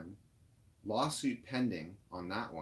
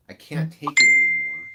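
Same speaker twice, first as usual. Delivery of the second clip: a real cry of frustration, much louder; frustrated outburst; 3 semitones lower, 16 dB louder than usual. Real, not acted. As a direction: let it burst out, at the end of your rope. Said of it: "I am so frustrated".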